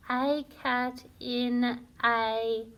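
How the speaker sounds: slow, word by word; slow reading aloud. Shown as a demonstration. Teacher reading the ditty slowly to children. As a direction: read each word slowly and clearly for beginners. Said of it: "I'm going to read it for you now".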